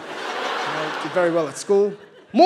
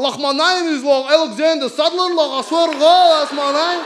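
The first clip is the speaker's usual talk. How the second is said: higher, louder, pleading insistence; nervous high insistence; 7 semitones higher, 6 dB louder than usual. Retold or imitated. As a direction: swear it's true, voice climbing. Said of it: "And he's like".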